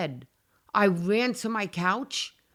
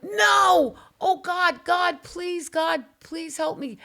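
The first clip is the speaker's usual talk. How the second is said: high, loud panicked cry of no, then desperate pleading to God; terrified scream; 8 semitones higher, 4 dB louder, slower than usual. Retold or imitated. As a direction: scream no, then beg for help, slowing down. Retold terror, begging God.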